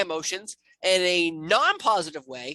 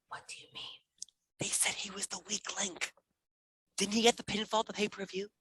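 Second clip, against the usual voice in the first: whisper; 2 semitones higher, 10 dB quieter than usual. Real, not acted. Echoing quietly.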